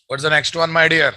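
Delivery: usual speaking voice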